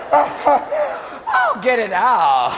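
high groaning cry; mock birthing wail; labor scream. Labor pain.

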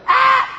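high, short mock wail; rehearsed cry. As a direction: practise a high fake scream. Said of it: "I could see them rehearsing emotions".

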